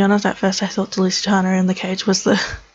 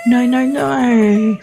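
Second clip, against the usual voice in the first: loud, drawn-out no no no; anguished protest; 4 semitones higher, 4 dB louder, much slower than usual. Acted. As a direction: wail the refusal. Madison protests leaving her horse.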